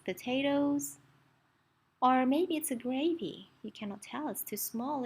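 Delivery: plain reading voice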